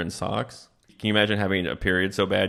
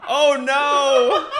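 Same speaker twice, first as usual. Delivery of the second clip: shocked laughing; 5 dB louder than usual. Real, not acted. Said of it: "realizing what fist means".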